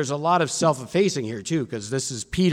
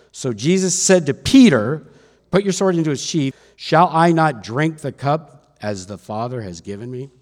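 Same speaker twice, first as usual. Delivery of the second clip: raised, emphatic Bible reading; scripture reading; 8 dB louder than usual. A narrator's reading. Preacher reads John's account of the arrest, raised emphatic scripture reading. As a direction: proclaim the account, punching the key words.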